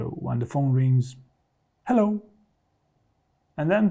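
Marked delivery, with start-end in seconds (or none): 1.9-2.2 casual hello. Shown as a demonstration. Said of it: "what you want to avoid at all costs is to just to say".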